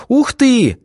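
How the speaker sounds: very high, thrilled exclamation; delighted gasp. A narrator's reading. Svetka seeing the hedgehog, delighted gasp. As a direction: gush with childlike wonder. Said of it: "восхитилась Светка".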